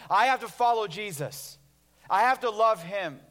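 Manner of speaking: loud, high, slow declaration; strained insistence. Acted. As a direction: belt out each word as a heavy obligation. Voicing fearful, effortful religious devotion.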